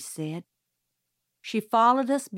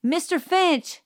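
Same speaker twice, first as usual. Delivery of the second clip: higher, calling out his name; shouting; 9 semitones higher than usual. A narrator's reading. Calpurnia shouting down the phone at Mister Finch, higher and urgent. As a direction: call his name down a bad line. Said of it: "she shouted".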